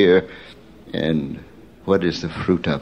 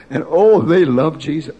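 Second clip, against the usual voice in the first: high, loud burst of delight; oh rises high with real joy; excited exclamation; 8 semitones higher, 3 dB louder than usual. Real, not acted. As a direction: gush with joy over them.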